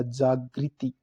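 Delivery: ordinary talk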